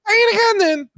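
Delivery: high-pitched challenge. Real, not acted. Rival daring him to repeat his answer, high-pitched challenge. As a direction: voice shooting way up.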